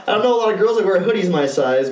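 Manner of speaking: ordinary delivery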